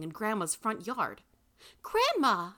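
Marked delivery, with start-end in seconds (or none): 1.8-2.5 loud, high exclamation; exclaimed. A narrator's reading. Granddaughter finds herself teleported to the front yard, exclaiming. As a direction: cry out in amazement. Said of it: "I exclaimed".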